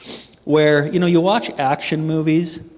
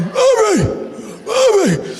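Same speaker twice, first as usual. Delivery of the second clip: very high, loud strangled squeak; fear-choked cry; 5 dB louder, slower than usual. Retold or imitated. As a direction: act out a scream choked by fear. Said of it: "there's a deaf mute at the front door".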